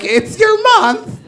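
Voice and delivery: very high sweet mock voice. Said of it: "Now they're like".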